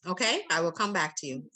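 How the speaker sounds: ordinary delivery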